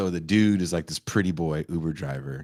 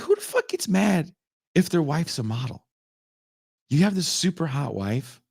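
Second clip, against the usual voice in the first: normal talking voice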